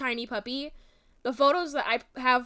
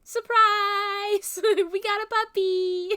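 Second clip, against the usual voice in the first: mock surprise; 9 semitones higher, slower than usual. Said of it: "and they were like".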